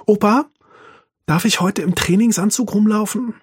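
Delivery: put-on high, eager little boy; a seven-year-old pleading sweetly; child voice. A narrator's reading. Enkel bittet den Opa im Bett, hohe Kinderstimme. Child Arthur asks grandpa.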